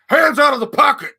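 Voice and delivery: loud, high-pitched bark; guard's shouted order. Retold or imitated. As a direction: yell it as an imitated courtroom guard. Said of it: "The guard is like".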